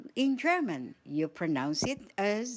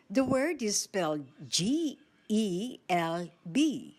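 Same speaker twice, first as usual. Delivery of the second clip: ordinary talk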